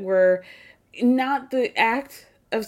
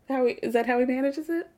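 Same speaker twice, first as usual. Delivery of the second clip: normal talking voice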